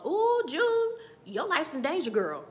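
put-on loud warning, starting high and dropping on "girl"; Whoopi Goldberg in Ghost. Psychic warning June her life's in danger, loud alarm. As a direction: cry out like a dramatic psychic. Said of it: "I felt like Whoopi Goldberg in Ghost".

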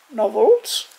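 usual speaking voice

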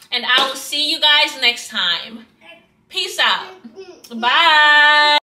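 bright YouTube outro energy; vlogger outro. Acted. Vlog sign-off outro.

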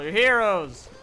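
loud cheer for the troops. Real, not acted. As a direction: shout it out proudly.

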